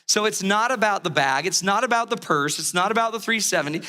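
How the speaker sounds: ordinary talk, nothing marked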